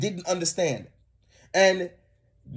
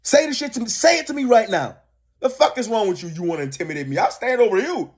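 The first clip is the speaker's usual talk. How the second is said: imagined confrontation; 6 semitones higher than usual. Retold or imitated.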